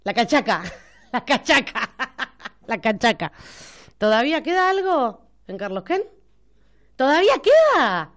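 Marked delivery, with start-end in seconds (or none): 0.0-3.3 cachaca giggled out with a hard k; laughing mispronunciation. Shown as a demonstration. Host laughing at saying cachaca wrong. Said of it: "¿cómo era la de". 7.0-8.1 surprised. Real, not acted. Surprised question.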